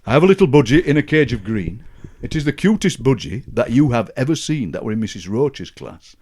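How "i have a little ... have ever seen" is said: put-on child's poem recital. Shown as a demonstration. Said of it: "And it went".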